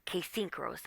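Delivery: softly coaching herself to calm down; hushed self-talk. Retold or imitated. Alone on the porch, quietly talking herself down. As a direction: murmur instructions to yourself quietly. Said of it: "I'm like".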